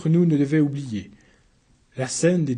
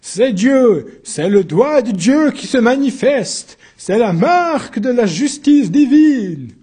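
high, loud, slowed preaching; fervent proclamation; 7 semitones higher, 7 dB louder, slower than usual. Acted. Priest backing the uncle before the court, loud slow preaching. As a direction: preach it over the room. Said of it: "Et le curé de renchérir".

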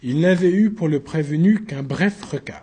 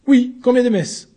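high, loud pressing repeat; insistent demand; 8 semitones higher, 5 dB louder than usual. Acted. Accused pressing the newcomer for an answer, loud high. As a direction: hammer the question home.

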